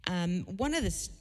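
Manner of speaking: ordinary delivery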